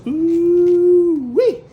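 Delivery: excited whoop. Real, not acted. Setting down the blue plate.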